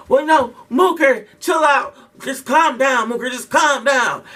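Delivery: grab him and beg him to stop; urgent restraining plea. Retold or imitated. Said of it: "Leah grabs me like".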